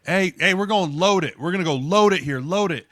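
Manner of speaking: mock yelled play call, pitched high; retold yelling. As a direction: imitate a teammate calling the play loudly. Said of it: "they're just yelling at each other".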